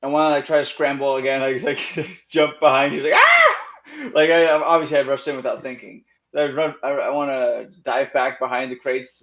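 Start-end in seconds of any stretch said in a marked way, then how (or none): 3.1-4.1 shrill startled yelp; high yelp. Acted. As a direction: yelp in sudden panic. Character yelps jumping away.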